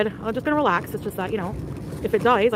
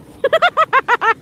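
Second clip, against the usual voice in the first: high, loud cackling laugh; maniacal cackle; 11 dB louder than usual. Real, not acted. Streamer laughing as the commentator mocks her, loud high cackle. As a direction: cackle like a serial killer. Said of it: "That laugh is a ... serial killer".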